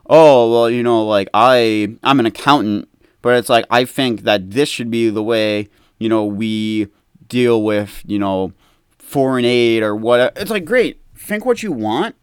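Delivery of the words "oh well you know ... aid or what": mock know-it-all. Mocking an armchair expert.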